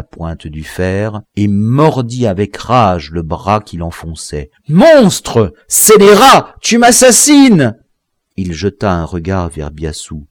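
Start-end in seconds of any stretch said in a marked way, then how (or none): 4.7-7.8 loud, very high, screaming accusation; anguished cries. A narrator's reading. The stabbed victim screams at his murderer, anguished. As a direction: scream at the man stabbing you.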